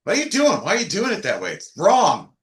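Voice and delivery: high, swooping mock scolding; imitated blunt criticism. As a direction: imitate a bandmate bluntly telling you off. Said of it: "Karen will tell me, like".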